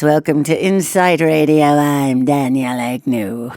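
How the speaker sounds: low, sexy radio-host voice; Brenda Vaccaro. Shown as a demonstration. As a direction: purr the show intro down low. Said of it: "low Brenda Vaccaro sexy voice".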